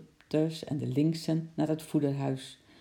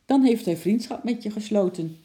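raised, higher answering call; calling back; 6 semitones higher, 6 dB louder than usual. A narrator's reading. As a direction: call back across a distance. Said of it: "riep oom Nijltje terug".

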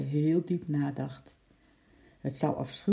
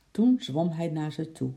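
plain reading voice

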